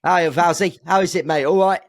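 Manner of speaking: hammed-up Cockney, chummy on the phone; Cockney phone call. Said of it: "I don't know why they're Cockney at Brackley".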